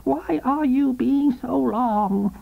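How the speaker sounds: high, slow, whining complaint; peevish despairing invalid. A narrator's reading. Invalid wife complaining to her slow husband, whiny. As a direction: drag it out, sick and fed up. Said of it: "peevish and despairing".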